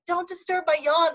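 high-pitched retold indignation; mock mad. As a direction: protest in a high, play-angry voice. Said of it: "I would get so mad".

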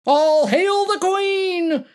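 high, loud, drawn-out mock proclamation; grand high-pitched cry. A narrator's reading. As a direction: hail her like a herald, topping everyone. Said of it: "Michael cried out, topping them all".

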